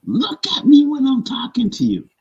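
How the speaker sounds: high, loud mock demand. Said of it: "raised her voice and said".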